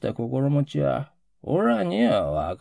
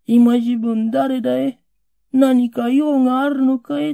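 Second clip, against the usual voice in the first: sleepy voice; 8 semitones higher, 5 dB louder than usual. Retold or imitated. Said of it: "寝とぼけたような声で、".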